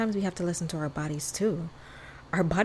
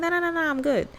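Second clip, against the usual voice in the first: much higher, quick reassuring no's; friend reassuring; 11 semitones higher, 3 dB louder, faster than usual. Retold or imitated. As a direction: brush off the worry lightly, pitched up. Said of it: "She's like".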